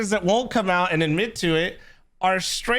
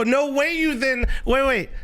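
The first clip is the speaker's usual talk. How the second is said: ordinary talk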